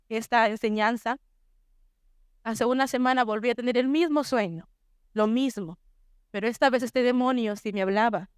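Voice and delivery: ordinary talk, nothing marked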